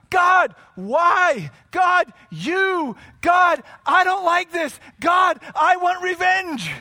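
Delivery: high, shouted complaint to God; loud venting, each "God" flung out; frustrated, angry outcry. Acted. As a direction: shout it upward like an acted angry psalmist. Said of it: "writers poured out their frustrations".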